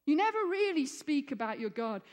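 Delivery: usual speaking voice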